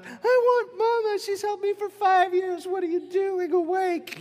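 very high, loud mock child's wail. Child crying for Mama after the bump, hammed-up wail. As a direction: imitate a crying kid protesting at full pitch. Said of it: "they're crying on top of their crying".

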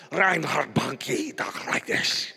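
slow, heavily put-on foreign accent; mock a thick, weird foreign accent, drawn out; Reinhard Bonnke accent. Preacher imitating a revivalist's weird accent. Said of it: "he's got this weird accent".

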